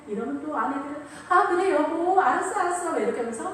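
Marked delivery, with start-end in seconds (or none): 1.3-2.9 higher, sweet wife humoring husband; wife eagerly pleasing her husband. Acted. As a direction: coo agreement to keep him coming.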